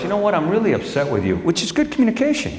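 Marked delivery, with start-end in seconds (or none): 0.0-1.4 higher mock wife voice; imitating wife. Comedian quoting his wife's complaint in a higher voice. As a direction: play the upset wife lightly. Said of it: "My wife goes".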